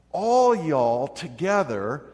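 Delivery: slow, loud Southern drawl on All y'all together; Southern accent. Pretend. Pastor voicing Jesus to his congregation in a Southern accent. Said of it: "picture Jesus talking with a Southern accent".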